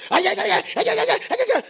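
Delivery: high, loud "yeah, yeah" run; egging on a fight, hammed up; mock excited agreement. Mimics an agitated reply.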